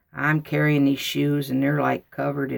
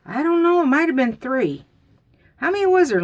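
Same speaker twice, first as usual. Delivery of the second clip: much higher, squeaky unsure voice; squeaky child; 11 semitones higher, 4 dB louder than usual. Retold or imitated. Girl to mom, squeaky unsure answer. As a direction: sound small and caught out.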